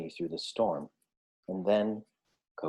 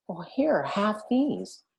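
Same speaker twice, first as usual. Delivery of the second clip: higher, kindly offering voice; Little Hedgehog; 11 semitones higher, slower than usual. A narrator's reading. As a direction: offer them gently like a small hedgehog. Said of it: "said Little Hedgehog".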